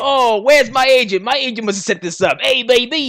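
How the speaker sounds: high, loud showboating caricature; Max's obnoxious voice. Retold or imitated. Comic calling for his agent, mock obnoxious holler. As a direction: holler like a self-satisfied crass comic. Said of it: "This is how he actually sounds".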